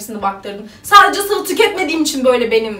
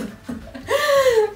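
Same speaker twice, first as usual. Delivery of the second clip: high laugh; 10 semitones higher than usual. Real, not acted. Laughs at her own belly.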